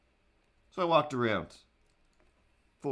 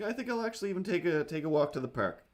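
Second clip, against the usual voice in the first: breezy plan; 6 semitones higher, faster than usual. Retold or imitated. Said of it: "I was like".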